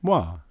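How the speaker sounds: Wa said short, w plus ah; wa sound. Shown as a demonstration. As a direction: demonstrate Wa clearly. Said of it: "with an A sound at the end".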